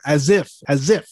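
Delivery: ASIF said "az-IF"; Z in the name. Shown as a demonstration. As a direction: buzz the S of Asif as a Z. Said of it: "put a Z in there".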